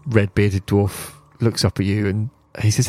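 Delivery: ordinary talk, nothing marked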